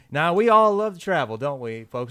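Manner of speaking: high, loud cheesy salesman pitch. Retold or imitated. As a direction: ham up a slick timeshare presenter.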